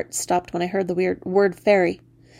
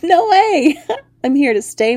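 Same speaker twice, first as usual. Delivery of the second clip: much higher and louder, laughing; gleeful refusal; 7 semitones higher, 6 dB louder than usual. Acted. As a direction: crow with delight at her. Frog refusing to leave.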